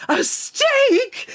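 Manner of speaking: mock high-pitched incredulous shriek; Mark's shrill disbelief. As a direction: shriek it as if outraged by a steak. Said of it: "And then Mark goes".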